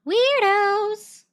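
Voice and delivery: high squeaky mocking weirdos; mocking jab. Real, not acted. Streamer mocks the callers, high and squeaky. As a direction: toss it off in a high taunting squeak.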